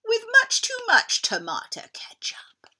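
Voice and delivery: high, breathy gloating whisper. Acted. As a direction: hiss the last words with delight. Dinosaur's gloating final threat.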